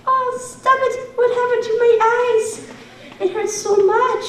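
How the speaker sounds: high, squealing troll in pain. Acted. Troll gets cheese in eyes.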